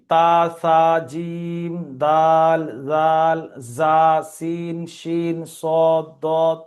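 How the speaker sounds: slow, drawn-out letter names on one level note; memorisation recital. Shown as a demonstration. Teacher lists the fifteen ikhfa letters for memorising, slow drawn-out recital. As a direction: recite each letter separately, stretching it evenly. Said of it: "এরকম আপনি মুখস্থ করে নেবেন".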